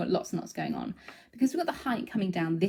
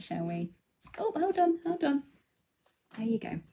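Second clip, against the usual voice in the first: ordinary talk, nothing marked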